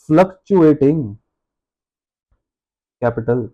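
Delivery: slow, low dictation with long gaps; dictating. Real, not acted. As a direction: spell it out slowly as you write. लिखवाते हुए शब्द बोलना. (धीरे-धीरे लिखवाते हुए)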